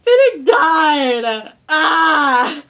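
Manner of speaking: hammed-up slow wailing cry, very loud; sobbing shout. Retelling crying to mom, mock wailing shout. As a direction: wail it like a heartbroken fan. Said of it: "I was like".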